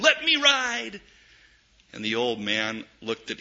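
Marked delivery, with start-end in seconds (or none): 0.0-1.0 put-on high child's calling voice; a boy calling to the riverboat again. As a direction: keep calling to the boat. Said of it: "he kept on calling".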